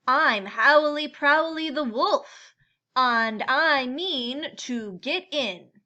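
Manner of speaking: loud, pushy wolf demanding entry; a big bad wolf who won't take no, loud. Wolf telling the animals he means to get in, loud and pushy.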